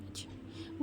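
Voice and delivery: breathless, whispered shock. A narrator's reading. Stunned girlfriend hearing the betrayal, in a shocked whisper. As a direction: barely voice the word, stunned. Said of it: "Bhavya shocked".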